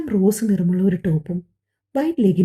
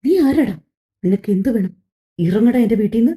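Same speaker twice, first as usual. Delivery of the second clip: angry. Acted. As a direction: throw him out of the house. Woman ordering intruder out. (വീട്ടിൽ നിന്ന് ഇറക്കിവിടാൻ ഉച്ചത്തിൽ ആക്രോശം)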